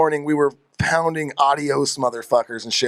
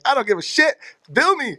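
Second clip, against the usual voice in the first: high-pitched reckless bravado; mock defiance; 8 semitones higher than usual. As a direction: toss it off high, daring them. Retold defiant quote while trashing room.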